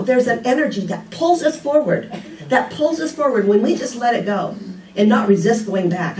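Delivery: usual speaking voice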